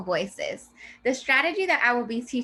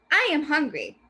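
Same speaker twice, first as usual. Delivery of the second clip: loud, excited exclamation, higher; exclaimed; 5 semitones higher, 3 dB louder than usual. Shown as a demonstration. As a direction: read it as if shouting you're hungry. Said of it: "will be read this way with an exclamation point".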